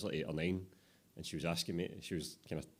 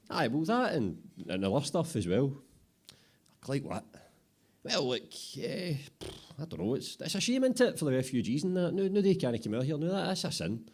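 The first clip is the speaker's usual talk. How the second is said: much higher, flustered squeaky voice; flustered apprentice; 9 semitones higher, 4 dB louder than usual. Acted. Apprentice struggles to justify himself in a squeaky flustered voice. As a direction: scramble for an excuse, pitch climbing.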